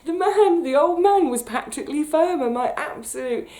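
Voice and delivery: high, loud, voice welling up with feeling; reliving meeting her hero, choked with excitement; overcome with emotion. Real, not acted. Tells viewers her hero was the old man, overwhelmed and emotional. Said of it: "I'm gonna get all emotional".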